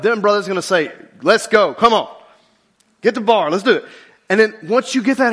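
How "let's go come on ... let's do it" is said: louder, pushy gym encouragement; gym buddies hyping. Retold or imitated. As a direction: shout him on like a spotter. Gym buddies pushing him to lift.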